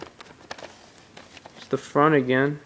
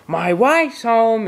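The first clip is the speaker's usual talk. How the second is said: high, slow, dismayed "my wife's home"; all upset; 7 semitones higher, slower than usual. Retold or imitated. Husband dreading his wife's return, high slow dismay. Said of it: "and he's, like, all upset".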